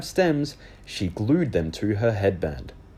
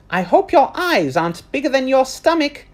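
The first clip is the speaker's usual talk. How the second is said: higher, louder friendly server; lunch lady voice; 6 dB louder than usual. Acted. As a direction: offer food over the counter.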